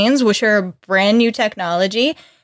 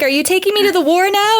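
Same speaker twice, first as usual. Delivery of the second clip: much higher, bright eager question; eager Diana; 9 semitones higher than usual. Retold or imitated. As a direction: ask it like a kid promised a treat. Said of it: "Or she's like".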